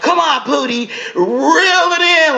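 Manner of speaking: high, drawn-out teasing woman's voice; high mocking coax to reel it in; mock Omarosa. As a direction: pitch way up and stretch out reel it in. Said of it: "Omarosa was like".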